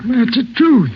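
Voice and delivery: defensive outburst at the ranger; insistent protest. Acted. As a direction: push back hard, voice up. Suspect protests under interrogation.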